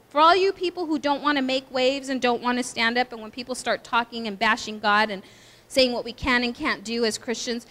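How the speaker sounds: normal talking voice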